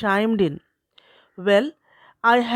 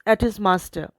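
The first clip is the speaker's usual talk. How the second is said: ordinary delivery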